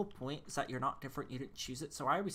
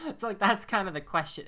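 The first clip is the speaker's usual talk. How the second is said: words spoken through laughter, louder; laughing; 4 semitones higher, 9 dB louder than usual. Real, not acted. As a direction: keep laughing as you say it.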